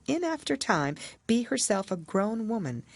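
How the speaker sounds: ordinary delivery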